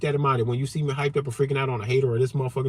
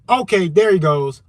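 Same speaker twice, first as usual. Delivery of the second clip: louder, higher imitated reaction; mock onlooker's outcry; 6 semitones higher, 9 dB louder than usual. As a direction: call it out like a bystander sounding off. Voicing an alarmed onlooker's reaction.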